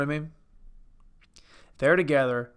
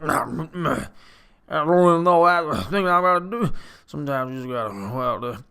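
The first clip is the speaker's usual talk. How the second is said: mumbling movie star, louder on the middle line; Bradley Cooper impression; 4 dB louder than usual. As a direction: grumble it like you're drowning in your accent. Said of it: "It's a low grumble with an accent".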